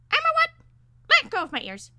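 high, loud squawk of offence; piercing, affronted bird voice; outraged parakeet. A narrator's reading. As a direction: screech indignantly as a small bird. Insulted parakeet held by ears.

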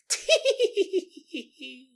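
a short high ghostly titter; high giggle. Retold or imitated. The ghost woman laughing, one high titter. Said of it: "Once the woman laughed".

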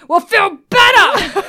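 drawn-out, high, very loud mock yell; mock insensitive shout. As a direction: bellow it as a blunt, exasperated retort. Blunt retold retort in movie recap.

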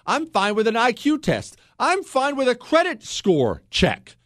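building real irritation, voice climbing high; worked-up talk-radio tirade; getting upset. Real, not acted. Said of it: "I'm sorry I'm getting upset".

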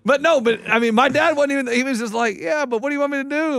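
high, loud and excited; telling it all fired up; excited retelling. Real, not acted. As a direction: voice jumps up with excitement. Excited retelling, dad voiced.

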